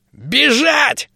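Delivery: high squeaky gnome shout. A narrator's reading. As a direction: squeak it out urgently. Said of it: "пропищал гном".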